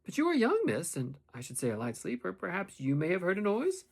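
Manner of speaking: feigned indifference with a pointed edge; marked, significant tone. A narrator's reading. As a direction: probe with loaded meaning, pitch lifting. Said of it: "in a marked and significant tone".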